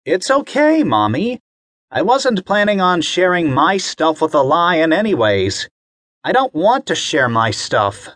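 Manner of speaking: a kid acting brave; proud boy. Bobby assuring mommy he is brave, high voice.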